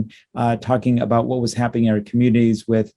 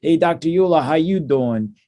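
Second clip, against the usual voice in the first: put-on a light Boston accent, raised and friendly; Derek's Boston accent; 5 semitones higher than usual. Derek greeting the doctor at the webcast start, light Boston accent. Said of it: "in his wonderful Boston accent would say".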